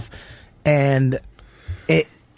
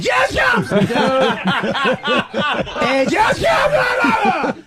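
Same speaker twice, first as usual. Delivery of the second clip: much higher laughing yell; laughing excited shout; 10 semitones higher, much slower than usual. Real, not acted. Describing actor's sudden rage.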